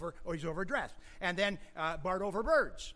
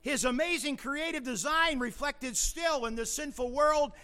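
loud, high-pitched pulpit proclamation; full-force preaching; 9 semitones higher, 6 dB louder than usual. Real, not acted. Preacher pressing the congregation on God's care, full-force preaching. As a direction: drive the point home to the congregation.

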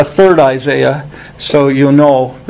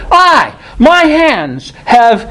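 "I" lifted high, the words drawn out slowly; emphatic I, slow; 7 semitones higher, much slower than usual. Shown as a demonstration. Preacher slowly reading God's emphatic "I" to the church. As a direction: stretch out the phrase, leaning on "I". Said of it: "it goes on to say it just that way".

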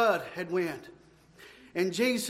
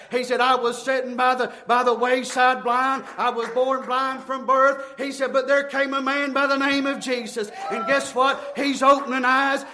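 driving, rhythmic revival sermon; full-force preaching; 2 semitones higher, 4 dB louder than usual. Real, not acted. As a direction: preach it hard without letting up.

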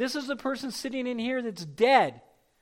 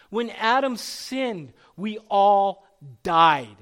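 slow, louder on died; solemn emphasis; 3 semitones lower, 6 dB louder, much slower than usual. Acted. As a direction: weigh down on we all died.

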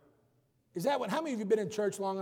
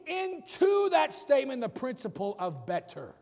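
preaching at full force; 7 semitones higher, slower than usual. Acted. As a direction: preach it out over the congregation.

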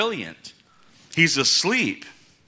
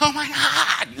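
sudden high mock panic; hammed-up terrified yelp; 12 semitones higher, slower than usual. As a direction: squeal it like a panicking disciple. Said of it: "running around screaming".

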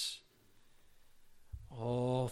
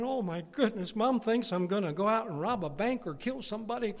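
put-on high, loud dismayed kid; mock alarm; 7 semitones higher, 7 dB louder than usual. Boy overhears mom's fears, mock alarm. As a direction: exclaim in hammed-up disbelief.

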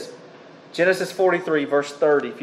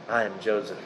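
hushed reveal; 5 semitones lower, 11 dB quieter than usual. Acted. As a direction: lower and much softer, almost breaking. Joseph reveals himself to brothers.

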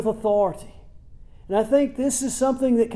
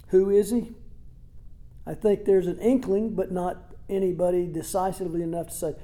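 usual speaking voice

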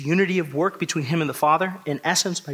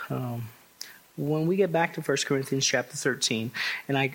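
ordinary delivery

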